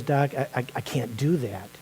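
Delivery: low, very soft, halting acted reply; glum refusal. Russian man in a joke tells his doctor no, glum and hushed. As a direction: drop low and quiet, stumble into the refusal.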